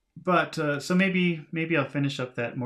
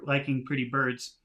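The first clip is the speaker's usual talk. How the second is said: usual speaking voice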